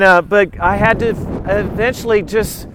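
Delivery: normal talking voice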